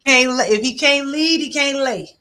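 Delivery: loud, high zinger; emphatic punchline; punchline emphasis. Real, not acted. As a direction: land the line hard and loud.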